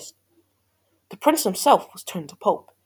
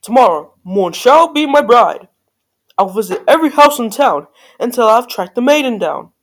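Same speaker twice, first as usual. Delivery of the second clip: loud, theatrical princely vow; declaring cry; 4 semitones higher, 8 dB louder than usual. A narrator's reading. Prince announcing his search for a bride, loud and declaiming. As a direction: proclaim it grandly, louder and higher. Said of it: "he cried".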